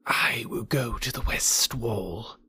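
slow, breathless whisper of a snake. A narrator's reading. Kaa whispering his plan to Bagheera. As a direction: hush it, plotting in the dark. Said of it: "Kaa whispered".

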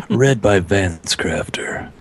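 slow breathy whisper. Pretend. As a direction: whisper it slowly. Sultry audiobook narrator joke.

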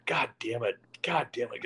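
breathless whisper, swearing under the breath; whispered cursing in the golf cart; whispered curse. Retold or imitated. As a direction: hiss it to yourself while driving. Cursing to himself while driving.

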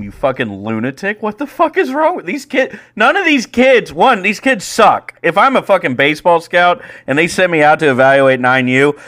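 loud, high-pitched retold tirade; exasperated rant. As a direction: lay into the coach, stressing each insult. Acted tirade at the ejected coach.

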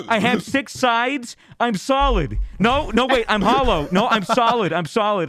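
high-pitched imitation of a freaked-out friend; tripping friend. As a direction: argue with yourself in high, mounting bewilderment. Imitates friend convinced he's a cube.

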